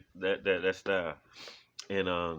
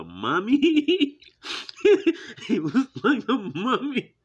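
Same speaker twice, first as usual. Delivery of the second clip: laughing; 9 dB louder, slower than usual. Real, not acted. Laughing while describing movie.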